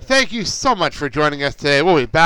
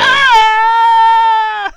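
high squeal; 10 dB louder than usual. Real, not acted. Startled squeal.

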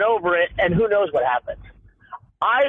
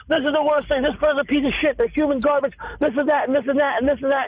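much higher, hammed-up ranting, speeding up at the end; over-the-top fake fury, pitched way up, rushing; mock outrage; 9 semitones higher, faster than usual. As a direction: parody a furious poster piling on insults. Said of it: "everyone shoots for this immediate reaction".

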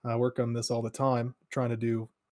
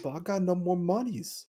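put-on kid voice; 7 semitones higher than usual. Kid voiced complaining, far timbre.

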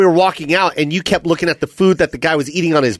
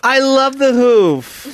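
slow, higher gushing voice; mock hipster; 7 semitones higher, much slower than usual. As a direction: gush over the hoof. Echoing the hipster mockery.

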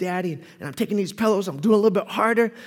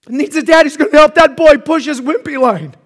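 ordinary talk

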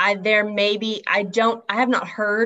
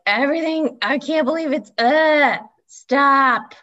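loud, higher exasperated whine; exasperated complaint; 6 semitones higher, 6 dB louder, slower than usual. Acted. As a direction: draw it out, as if begging time to move. Mock despair at a slow week.

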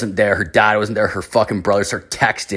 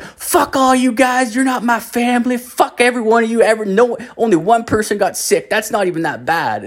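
put-on drunk angry uncle; 11 semitones higher than usual. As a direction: rant like a sloshed uncle at the party. Drunk uncle yells at family.

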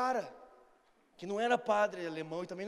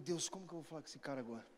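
low, very soft, quick aside to God; hushed inner prayer; 9 semitones lower, 13 dB quieter than usual. Retold or imitated. Asking God how to approach this guy, hushed. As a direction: murmur it privately, fast and low.

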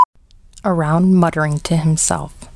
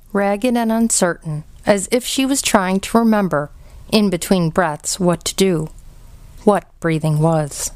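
ordinary delivery

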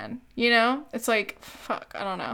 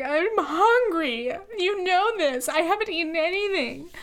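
little tantrum; 7 semitones higher than usual. Retold or imitated. Hungry girlfriend re-enacts whining at boyfriend, little mock tantrum. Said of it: "broke into a little tantrum there".

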